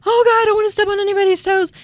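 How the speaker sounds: high, anxious gasp of a timid agent; mock worried voice. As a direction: squeak it out like you're scared to offend. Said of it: "we feel like".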